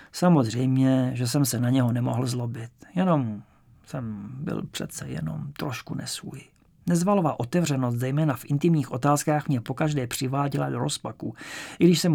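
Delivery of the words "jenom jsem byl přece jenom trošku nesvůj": much softer and slower, uneasy; quiet, uneasy. A narrator's reading. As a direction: murmur it, a bit out of sorts. Narrator uneasy aside. (tiše a nesvůj)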